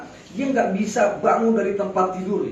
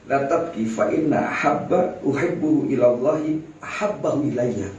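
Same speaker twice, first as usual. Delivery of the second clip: Arabic recital; 6 semitones lower than usual. Retold or imitated. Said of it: "Apa kata Imran bin Hussein?".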